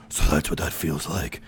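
low, soft, put-on brooding voice; Batman. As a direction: drop low for a mock masked-hero line. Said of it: "Who are you doing that voice for?".

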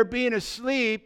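ordinary delivery

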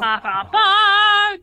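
high, slow, loud sing-song; mock taunting noise. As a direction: tease with a nonsense chant.

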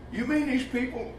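high-pitched mock disbelief; incredulous objection. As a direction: question it as a doubting listener would. Voices a listener's incredulous objection.

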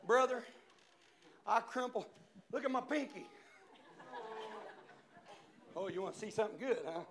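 ordinary delivery

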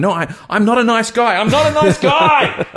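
very high, loud, building wail; mock desperate yell. Retelling chasing his date downstairs half undressed, mock desperate yell. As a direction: yell it after her, comically desperate. Running after her, calling out.

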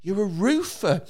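high-pitched mock presenter; quick, high, feigned-innocent questioning; needling TV host. Host asking the dad about his job, mock needling.

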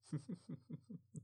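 a short, high, breathy burst of laughter; quick soft giggle; real laugh. Real, not acted. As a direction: giggle caught off guard. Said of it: "elbow me for laughing".

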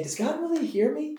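high, pleading, unsure; doubting question. Retold or imitated. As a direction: ask it like real doubt. Voicing own doubting question.

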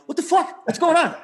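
high, loud frustrated outburst; angry yell. Retold or imitated. Furious at broken Bluetooth.

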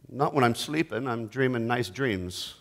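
soft, low, sleepy reply; drowsy mumble. Acted. Sleeping Jesus brushing off the disciples, drowsy and soft. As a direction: answer half asleep.

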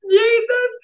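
high, slow, strained in pain; pained plea. Retold or imitated. Mother in the bathroom, high pained whimpering to Jesus. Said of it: "I was like".

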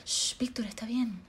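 soft hushing whisper; mother whispering to calm; whispered mom. Retold or imitated. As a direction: hush him without waking anyone. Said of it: "my mom's like".

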